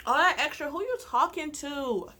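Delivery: incredulous. Real, not acted. Incredulous comeback.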